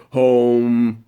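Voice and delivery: long, held "home" humming in the chest; chest resonance. Shown as a demonstration. Coach showing artists where vibration is felt, chest resonance. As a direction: draw out "home" slowly on one steady note. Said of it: "a vibration in your chest".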